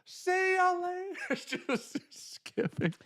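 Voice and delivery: very high, slow, airy squeak; high, drawn-out playful send-off; mock skipping phone voice. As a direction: voice the phone as it skips away. Mimicking phone skipping across water.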